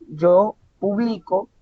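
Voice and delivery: slow, drawn-out "Yo publico", weight on BLI; stress on middle syllable. Shown as a demonstration. Teacher repeating the exercise, middle syllable of "publico" stressed. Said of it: "vamos a hacer el ejercicio nuevamente".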